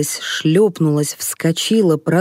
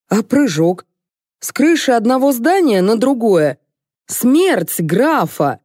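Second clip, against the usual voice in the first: raised, higher, insistent delivery; indignant protest; 7 semitones higher, 4 dB louder than usual. A narrator's reading. Director stops the actress leaving before the stunt, indignant. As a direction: insist on what is still owed, outraged.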